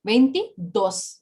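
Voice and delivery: "Veintidós" slowly, pausing before a stressed "dós"; slow teaching demo of "Veintidós", accented at the end. Shown as a demonstration. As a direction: split "Veintidós" and land on the last syllable. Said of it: "with an accent".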